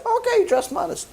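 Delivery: mock her claim in a high put-on voice; sarcastic mock agreement. Heavenly court sarcastically echoing the woman, mocking.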